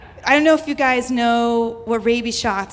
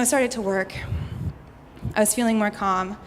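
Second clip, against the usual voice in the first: normal talking voice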